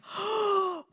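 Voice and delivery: high, audible gasp of amazement; delighted gasp. Retold or imitated. Reacting to friend standing up, delighted gasp. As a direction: draw in a thrilled breath.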